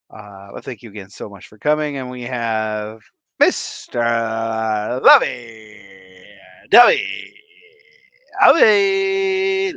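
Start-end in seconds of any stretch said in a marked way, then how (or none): 3.4-9.7 each word stretched apart, louder, like a ring announcer; drawn-out announcer call. Acted. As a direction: announce every word slowly and grandly.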